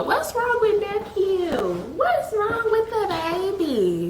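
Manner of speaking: put-on high, slow cooing baby talk; fussing commenter. Commenters fussing over the crying boyfriend, high cooing baby talk. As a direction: coo like an aunt over a baby. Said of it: "And here y'all go".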